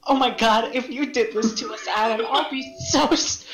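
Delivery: ordinary talk, nothing marked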